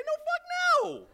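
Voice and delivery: the same buddy, now flatly refusing; Sugar's emphatic no. Retold or imitated. Sugar refusing a question, hammed-up squealed no. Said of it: "he'd say".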